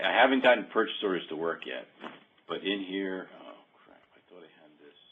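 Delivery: normal talking voice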